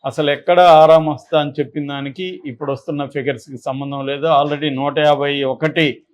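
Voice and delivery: normal talking voice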